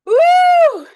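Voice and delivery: high, loud whooping call. Real, not acted. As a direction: whoop out across the yard. Calling out to chickens.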